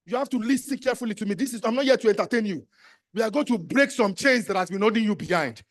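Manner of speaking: usual speaking voice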